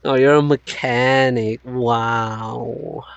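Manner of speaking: usual speaking voice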